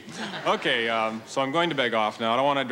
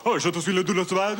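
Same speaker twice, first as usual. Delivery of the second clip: higher-pitched gush of mock French; rapid French waiter; 5 semitones higher, faster than usual. French waiters answering a tourist they think is fluent, gushing mock French. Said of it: "think you speak French. They go".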